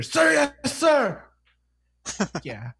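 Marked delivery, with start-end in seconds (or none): 0.0-1.2 very high, loud military response; drilled recruit shout. Acted. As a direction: bellow it like a hammed-up obedient recruit. Acted recruit two weeks later.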